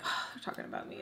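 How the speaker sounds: hushed, gasped aside to oneself; mock panicked whisper. Overhearing girls gossip about their makeup, imitated panicked whisper. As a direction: whisper it as a dawning realization.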